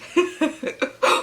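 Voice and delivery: short high giggle; real high-pitched chuckle; real laugh. Real, not acted. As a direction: burst out in a quick giggle. Laughs at the plot.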